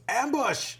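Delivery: sudden high shout. A narrator's reading. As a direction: yell out the warning. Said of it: "A ranger shouts".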